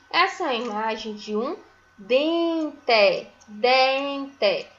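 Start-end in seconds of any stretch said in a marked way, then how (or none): 2.0-4.7 "dente" stretched as "den-TE" with a full final e; syllable by syllable. Shown as a demonstration. Teacher naming a picture for pupils, "dente" syllable by syllable. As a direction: sound out "dente" in two clear beats. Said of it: "começa com a sílaba D".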